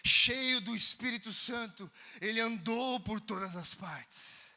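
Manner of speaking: slow preaching cadence; slow sermon. Acted. Preacher exhorting congregation, slow reverent cadence.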